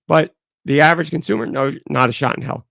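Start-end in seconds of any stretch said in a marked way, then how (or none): none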